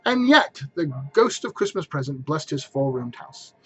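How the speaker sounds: usual speaking voice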